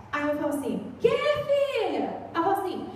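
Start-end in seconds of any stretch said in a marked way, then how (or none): 1.0-2.2 slow, high drawn-out reply; mother answering. Retold or imitated. Mother answering her daughter's call, slow and high. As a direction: answer the child patiently, stretched out.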